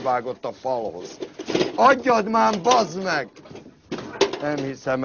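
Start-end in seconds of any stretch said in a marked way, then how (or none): 1.7-3.3 impatient yell. Acted. Impatient demand for the tool.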